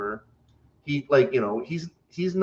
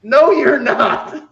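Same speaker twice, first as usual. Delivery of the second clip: laughing; 10 semitones higher, 9 dB louder, much slower than usual. Retold or imitated. Mocking coworker's claim, laughing.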